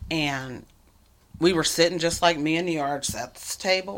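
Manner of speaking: ordinary talk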